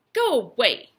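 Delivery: loud, sharp dismissal; irritated, forceful order to leave; cross. A narrator's reading. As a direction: shoo them off crossly and loudly. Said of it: "said Ralph crossly".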